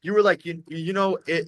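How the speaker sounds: ordinary delivery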